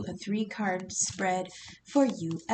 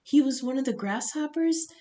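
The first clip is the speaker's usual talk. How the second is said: ordinary talk, nothing marked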